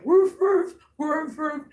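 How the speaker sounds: high, loud doubled woofs; imitated dog barks. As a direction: bark like excited dogs in a high voice. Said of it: "Even the dogs is out there".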